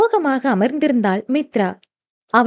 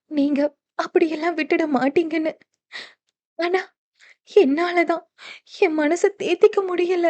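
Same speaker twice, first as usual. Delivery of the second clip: softer, higher echo of his vow; tearful wife; 5 semitones higher, 4 dB quieter than usual. Acted. Wife repeating Dev's vow, soft and tearful. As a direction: echo his promise back, small and teary.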